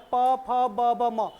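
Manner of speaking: प फ ब भ म spoken very slowly, lips meeting on each letter; slow letter demo. Shown as a demonstration. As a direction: let the class hear the lips close on every letter. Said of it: "होंठ आपस में मिल रहे हैं".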